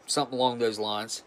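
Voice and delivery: usual speaking voice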